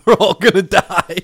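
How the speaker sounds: put-on loud, panicky kid's yell; child yelling. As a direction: shout it like a scared kid on a plane. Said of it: "my son yelled".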